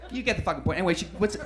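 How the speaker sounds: ordinary talk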